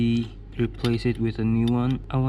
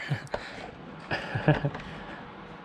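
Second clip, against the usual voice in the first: breathy laugh; 3 semitones higher, 6 dB quieter than usual. Real, not acted. Laughs after breaking wire.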